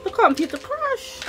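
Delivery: high-pitched retold disbelief. Reliving the news that the computer crashed, in disbelief. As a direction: voice jumps up, replaying the shock.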